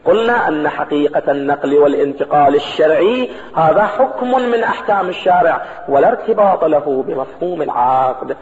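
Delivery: ordinary talk, nothing marked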